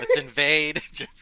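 loud, high, laughing through the words; laughing joke. Real, not acted. Guest joking with the host, laughing loudly. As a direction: giggle out a mock battle cry. Joking about fans invading Japan.

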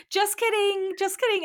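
a squeaky, nervous backpedal; mock nervous backtrack. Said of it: "starts trying to backpedal".

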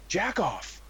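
high mock yell, no real volume; retold child's scream. Father imitates six-year-old son during the movie, mock scream. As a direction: imitate a small boy blurting it out. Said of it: "My six-year-old son just screams".

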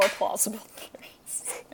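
whispered with a giggle; a laughing whispered concession. Real, not acted. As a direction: slip it in under your breath.

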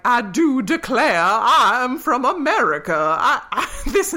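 a mock Southern American accent, laughing through it; Southern drawl. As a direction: put on a hammy Southern accent, breaking into laughs. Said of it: "the brother was like".

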